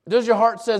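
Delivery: ordinary delivery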